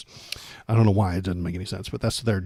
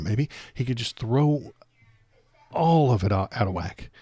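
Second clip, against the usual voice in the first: usual speaking voice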